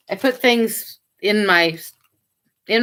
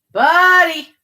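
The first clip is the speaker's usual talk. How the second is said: high, loud happy call; light up at the dog; excited greeting; 7 semitones higher, 6 dB louder than usual. Real, not acted. Owner greeting her dog on camera, excited high call.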